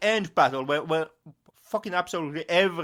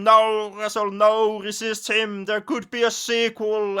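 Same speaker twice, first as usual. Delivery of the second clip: louder, higher urgent plea; mock yell; 7 semitones higher, 4 dB louder than usual. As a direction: yell at him to hold on. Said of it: "a second priest who yells at him".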